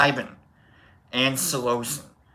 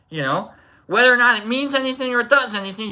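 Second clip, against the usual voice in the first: ordinary talk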